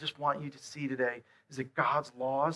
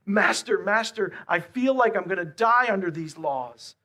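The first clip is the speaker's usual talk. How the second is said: pleading cry; 4 semitones higher, 6 dB louder than usual. Acted. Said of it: "that deep echo of your soul that says".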